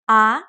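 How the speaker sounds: "Ah" with a clean upward glide; second tone. Shown as a demonstration. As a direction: lift the pitch upward through "Ah". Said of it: "and goes up".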